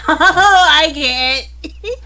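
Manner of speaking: high, loud laugh breaking through; laughing while realizing. Real, not acted. Laughing at the bidding joke.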